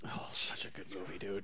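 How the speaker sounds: very soft, whispered aside; hushed admiration. Real, not acted. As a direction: whisper it fondly. Fond aside about a film.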